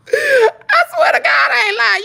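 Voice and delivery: begging the audience to believe her; emphatic oath. Real, not acted. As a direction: swear it's true with everything. Swearing the story is true.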